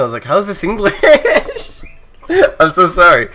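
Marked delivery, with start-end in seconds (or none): 0.2-1.8 high-pitched baffled question cracking into laughter; mock-incredulous and giggly; laughing disbelief. Retold baffled reaction, breaking into laughter. 2.6-3.3 high, loud apology through laughter; real laughter in the voice; laughing apology. Real, not acted. Apologising while laughing at own mistake.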